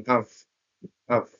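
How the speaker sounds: "আফ" short and unstressed, not a full off; weak form of. Shown as a demonstration. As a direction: say of as a quick "আফ". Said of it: "খুবই দ্রুত তারা উচ্চা-, উচ্চারণ করে".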